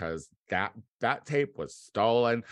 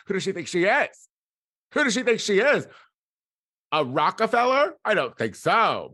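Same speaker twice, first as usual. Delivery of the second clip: high, loud mock outrage in a put-on voice; mock snooty lady; 10 semitones higher, 7 dB louder than usual. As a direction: sneer at herself like a snob. Mocking herself as a baller.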